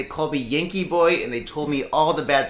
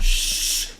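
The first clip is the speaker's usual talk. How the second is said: long voiceless "shh"; playful hushing shh. Pretend. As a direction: hush them as if guarding a secret. Hushing guests over joking secret.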